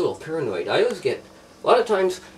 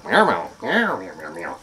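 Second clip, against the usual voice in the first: playful mock cat meowing; cat meows; 5 semitones lower, slower than usual. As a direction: meow like a needy little cat. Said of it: "they understand when I go".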